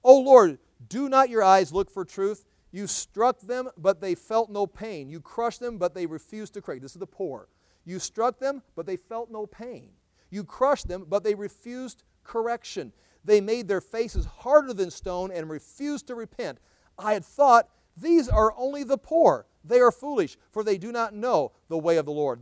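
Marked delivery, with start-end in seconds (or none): 0.0-6.8 loud, slow scripture proclamation; fiery preaching. Acted. 7.8-22.4 loud, slow scripture proclamation; fiery preaching. Acted.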